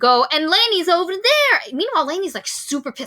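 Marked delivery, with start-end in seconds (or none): 0.3-1.6 mock tattling. Mock snitching line, loud.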